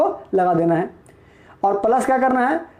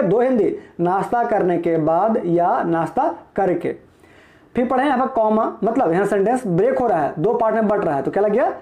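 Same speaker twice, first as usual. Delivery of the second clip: ordinary delivery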